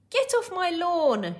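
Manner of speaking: high, loud, indignant yell; angry shout. Acted. Man ordering someone off his lawn, shouting. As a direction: shout it angrily. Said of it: "shouted the man".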